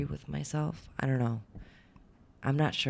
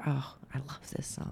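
soft unvoiced whisper; 2 semitones higher, 7 dB quieter than usual. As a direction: whisper it like a guest enjoying the song. Dinner guest reacting to music.

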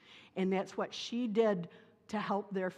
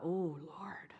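breathless whisper to herself; whispered prayer; 3 semitones lower, 6 dB quieter than usual. Retold or imitated. She prays in a whisper, unsure how to help the tough coworker. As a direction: whisper it like a panicked private prayer.

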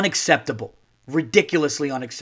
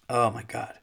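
ordinary talk

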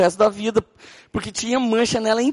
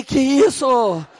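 shocked drawn-out question; 5 semitones higher, 3 dB louder, much slower than usual. Retold or imitated. Pastor stopping his friend from fighting, slow shocked disbelief. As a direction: stretch out a slow, stunned que isso.

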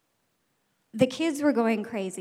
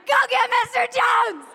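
high, loud shout for help; panicked shout; 13 semitones higher, 6 dB louder than usual. Retold or imitated. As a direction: yell across the room for help. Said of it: "And I screamed".

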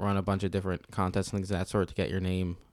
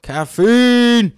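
very high, loud, drawn-out cry; exuberant shout; 13 semitones higher, 14 dB louder than usual. Pretend. Co-host answers the drink challenge, shouting high. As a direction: holler it with the last syllable held.

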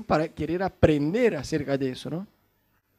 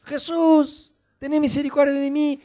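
high pleading call, pitch raised; louder cry; 7 semitones higher than usual. Retold or imitated. Blind man pleading louder with Jesus despite the crowd, high call. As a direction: cry out for mercy above the crowd. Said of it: "más alto le gritó el tipo a Jesús".

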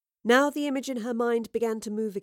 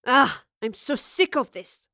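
loud, raspy, fed-up yell; rasping shout; 3 semitones higher, 6 dB louder than usual. Acted. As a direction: bark out the frustration. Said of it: "a rasping voice shouted".

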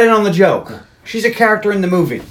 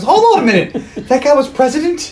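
high-pitched imitated double take; mock disbelief; 8 semitones higher than usual. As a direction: act out a startled movie character. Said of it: "she goes".

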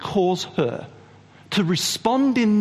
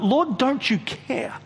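accusing; 6 semitones higher, slower than usual. Acted. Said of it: "Martha accuses him".

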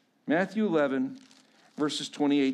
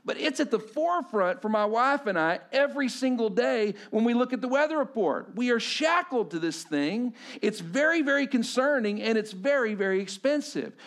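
higher, louder preaching with punched key words; impassioned preaching; 8 semitones higher, 5 dB louder than usual. Acted. Pastor telling the congregation how buried trauma stunts you, impassioned. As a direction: preach it hard, driving each point home.

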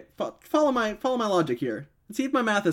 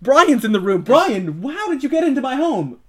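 panicked; 6 semitones higher, 5 dB louder than usual. Acted. Panicked at intruder.

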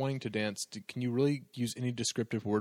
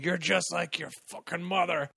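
mock yell, pitched up; retold angry yell; 4 semitones higher than usual. Retelling father berating his daughter, half-full angry yell. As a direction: imitate an angry father's outburst. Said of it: "he starts yelling at her".

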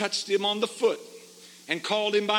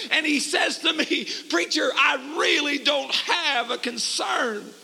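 preaching at full force; 7 semitones higher than usual. Real, not acted. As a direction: rise to a fiery pulpit cry.

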